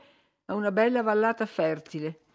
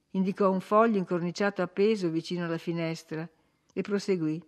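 ordinary delivery, nothing marked

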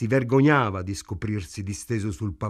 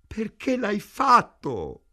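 wailing moan; 10 semitones higher, 4 dB louder, slower than usual. A narrator's reading. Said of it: "gemette Ida".

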